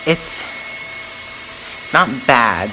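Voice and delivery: ordinary talk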